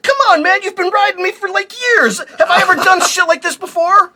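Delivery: high, loud pleading donkey voice; a wounded talking donkey whining; sassy donkey. Acted. Donkey pleading with Balaam after years of loyalty, high and whiny.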